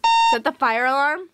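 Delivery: shouting over fire alarm. Real, not acted.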